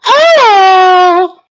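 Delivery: very high, loud mock climax scream; shrill fake climax cry. Friend hams up a fanfic ending with a loud mock cry. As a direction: shriek it out as a joke climax.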